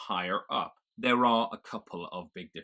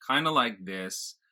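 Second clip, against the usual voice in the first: drawn-out General American accent; 4 dB louder than usual. Shown as a demonstration. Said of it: "thinking about a general American accent".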